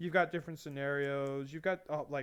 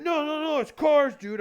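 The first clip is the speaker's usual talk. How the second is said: higher, louder dudebro protest; mock car-guy voice; 12 semitones higher, 9 dB louder than usual. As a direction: play the offended car guy, pitched up. Car guy defends his hobby.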